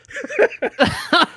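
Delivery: high throaty imitated cackle; mock laugh from the back of the throat; imitated Seth Rogen laugh. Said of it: "back of the throat".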